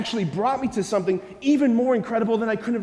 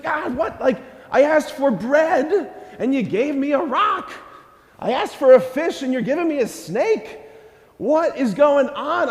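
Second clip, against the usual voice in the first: mock indignant complaint; 6 semitones higher than usual. Preacher voicing a let-down believer, mock indignant whine. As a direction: sound like a kid who got a rock instead of bread. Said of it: "You're like".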